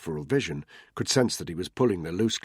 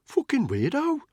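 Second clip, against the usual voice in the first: high-pitched Brummie jibe; Brummie accent; 4 dB louder than usual. A narrator's reading. Cab driver grumbling about a departed man, Brummie accent. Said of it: "muttered in a Brummie accent".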